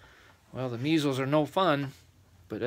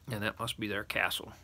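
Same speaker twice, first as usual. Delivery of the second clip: plain reading voice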